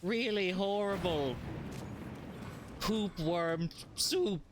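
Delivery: hammed-up pitch about worms and poop; mock earnest storyteller. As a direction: spin the gross tale like a solemn expert. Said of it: "I have to stop".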